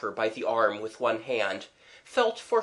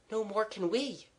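put-on high, soft girl's voice; agreeing girl; 10 semitones higher, 6 dB quieter than usual. Mabel agreeing they can't see her either, soft and high. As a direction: pitch it up softly.

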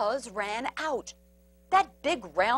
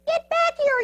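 very high, loud command; high-pitched little leader's shout; Pip yelling; 6 dB louder than usual. A narrator's reading. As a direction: yell high to call them back. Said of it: "yelled Pip".

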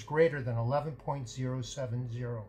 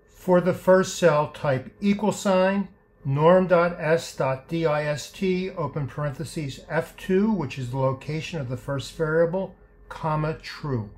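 dictating; 7 dB louder than usual. Shown as a demonstration. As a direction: spell out each keystroke. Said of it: "typing out a formula step by step".

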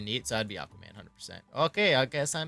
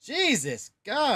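high, slow, horrified gasp of words; shocked exclamation; 11 semitones higher, much slower than usual. Real, not acted. Reacting in horror to a gross would-you-rather question, drawn-out shocked cry. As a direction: recoil at the gross choice.

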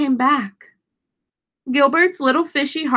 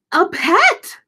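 excited fish voice; 6 semitones higher than usual. Acted. Gilbert sees the mouse.

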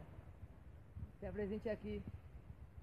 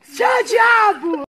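slow, high, long yell; 17 dB louder, much slower than usual. Real, not acted. Drunk friends shouting in street.

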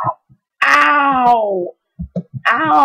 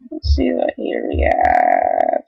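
deep announcer voice; 3 dB quieter than usual. Acted. Announcing the next level.

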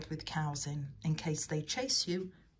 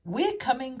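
high, urgent reassuring call; raised storybook rescue cry; 8 semitones higher, 4 dB louder than usual. A narrator's reading. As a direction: call ahead to someone in danger. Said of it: "cried Edward".

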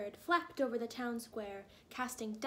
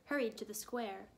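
usual speaking voice

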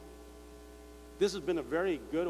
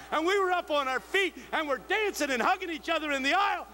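the preacher's rousing benediction to the congregation; preaching at full force; 9 semitones higher than usual. Acted. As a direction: drive the blessing home to the crowd. Sermon climax at full force.

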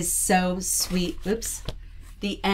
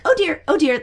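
high, louder little elf pipe; little high-pitched elf; 8 semitones higher, 5 dB louder than usual. Shown as a demonstration. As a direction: squeak it out as a small elf. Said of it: "that little high-pitched voice".

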